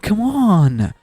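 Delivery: high, drawn-out plea of disappointment; exasperated protest. Retold or imitated. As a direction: protest it like a let-down fan, pitch swooping up. Reacting in dismay to the plot.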